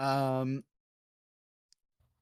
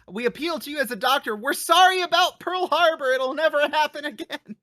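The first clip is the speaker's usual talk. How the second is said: loud, high-pitched pleading; hammed-up appeal, rising into a shout; mock desperate plea; 11 semitones higher, 7 dB louder than usual.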